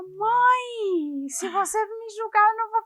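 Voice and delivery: high-pitched imitation of a child; mock childish protest, pitched well up; daughter's voice. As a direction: plead up high like a kid to mom. Acts out daughter confronting mother.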